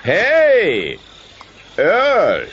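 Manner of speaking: high, loud outraged shout; indignant yell. Acted. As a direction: yell at him for the blow. Roy hit again, protests.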